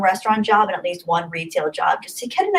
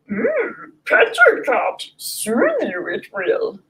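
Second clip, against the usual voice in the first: high, strained little Jedi master voice, slow at the end; Yoda voice; 4 semitones higher, much slower than usual. Shown as a demonstration. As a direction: do a squeaky Yoda with a hum first. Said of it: "I'm gonna say that in a Yoda voice".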